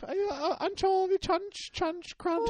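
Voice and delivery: very high, loud squeaky voice, slowing on the nonsense words; squeaky Italian grandmother. Pretend. Teasing William in a very high squeaky nonna voice. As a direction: squeak it out like a tiny nagging nonna. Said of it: "You sound like an Italian grandmother".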